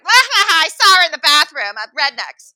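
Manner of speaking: very high, loud cackling taunt; hammed-up obnoxious impersonation; mocking woman's voice. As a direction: crow it across the room. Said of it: "Oh, wait, that's not her voice".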